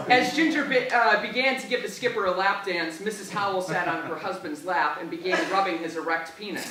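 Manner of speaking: usual speaking voice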